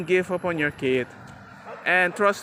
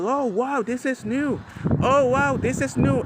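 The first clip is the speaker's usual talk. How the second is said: put-on higher, wide-eyed kid; amazed child; 6 semitones higher than usual. Son discovering a new place, amazed and higher. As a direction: gasp it like a kid seeing something new.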